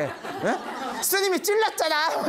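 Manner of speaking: high, giggling mock-innocent blurt; cheeky laughing retort. Novice laughingly blaming his master, high and giggly. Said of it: "이렇게 얘기 안 했겠죠".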